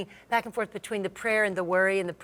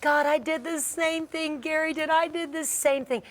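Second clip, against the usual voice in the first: higher, sustained cry to God; retold incredulous confession; 7 semitones higher than usual. Wife laughing on the floor to God, retold realization. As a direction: re-enact a giddy realization, pitched up. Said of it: "on the floor laughing, saying".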